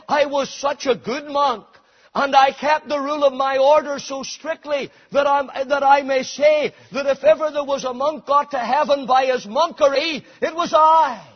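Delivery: loud, proud shout as Luther the monk; Luther boasting. Retold or imitated. Preacher voicing Luther's pride, shouting. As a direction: bellow Luther's boast of his monkery. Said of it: "He said".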